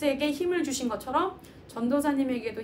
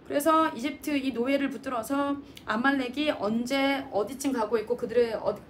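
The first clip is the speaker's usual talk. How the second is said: ordinary delivery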